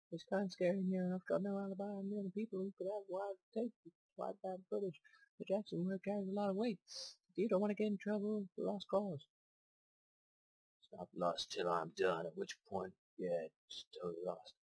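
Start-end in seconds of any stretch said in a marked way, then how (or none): 0.1-9.3 high character voice. Acted. Voicing game character dialogue.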